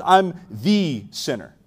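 slow, with "the" said as "thee"; stress on THE. Retold or imitated. Tax collector owning his sin, stressing "the" as "thee". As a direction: stretch "the" into an emphatic "thee". Acted confession contrasting the and a.

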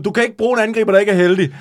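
loud call back to the coach; shouted retort. Acted. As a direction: shout it across the pitch. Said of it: "Og så råber du til ham".

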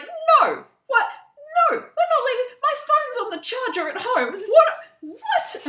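very high, loud incredulous squeals; panicked protest. Retold or imitated. Essie refusing to leave Edinburgh, in frantic high squeals.

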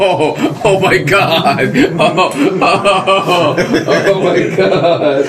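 high laughing oh my God. Real, not acted. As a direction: laugh through the words. Laughing fit at pun.

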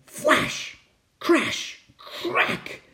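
breathy, unvoiced bursts; hushed, punchy storm effects; dramatic storm sound words. A narrator's reading. Narrator voicing the storm's flash and crash, breathy bursts.

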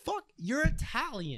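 high, slow exasperated yell; exasperated outburst. Pretend. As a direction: throw up your hands in disbelief. Exasperated retort to Sicilian claim.

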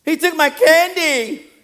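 put-on high-pitched, drawn-out childish complaint; whining child. Child tattling to the pastor, high whine. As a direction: play a wronged little kid, slow and high. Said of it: "It's the children do".

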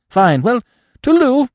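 high, breezy upper-class twit; chatty, airy young gentleman; silly young toff. A narrator's reading. Freddy's farewell.

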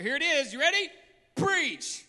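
loud, high preacher cry; preaching at full force. Acted. Preacher urging the church to go out, shouting. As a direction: thunder it to the congregation.

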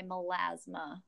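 "melasma" said with a broad Minnesota accent, the "a" flat and drawn. Real, not acted. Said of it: "your Minnesota accent just came out so bad when you said melasma".

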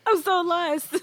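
cracking up at own confusion; laughing disbelief. Real, not acted. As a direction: throw up your hands, laughing. Repeats lost line, laughing.

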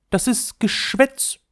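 hoch, langsam abgekanzelt; schnippische Prinzessin. A narrator's reading. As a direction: kanzle die Hofdamen ab. Prinzessin weist Hofdamen zurecht. (hohe, schnippische Prinzessin)

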